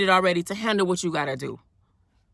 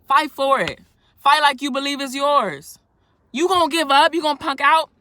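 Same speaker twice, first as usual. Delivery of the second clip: fired-up preaching; 6 semitones higher, 8 dB louder than usual. Real, not acted. Challenging the viewers to fight.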